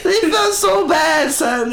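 high, loud laughing shout; excited shout. Real, not acted. Comedian laughing loudly about the hecklers feeling bad. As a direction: cry it out high, cracking up. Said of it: "It's very rare".

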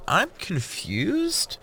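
slow, high, baffled; confused. Acted. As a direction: drag it out puzzled and high. Jordan baffled by crude joke.

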